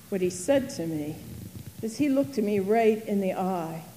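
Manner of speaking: normal talking voice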